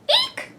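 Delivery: very high squeal; a tiny shrill eek of fright; squeak. Acted. Reader squealing for the children, high eek.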